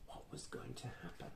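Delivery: fast, hushed whisper; whispered suspense; suspenseful whisper. A narrator's reading. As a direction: whisper it breathlessly to the children. Suspense aside to listeners.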